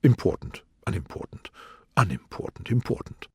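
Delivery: soft, musing undertone; low voice to himself. A narrator's reading. King testing words to himself, in an undertone. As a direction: try out each word under your breath. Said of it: "to himself in a low voice".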